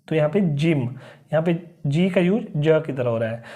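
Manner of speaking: ordinary talk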